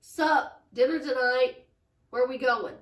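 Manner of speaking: loud, offhand bro voice; casual guy. Retold or imitated. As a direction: toss it off like a careless boyfriend. Imitating the guy's casual text.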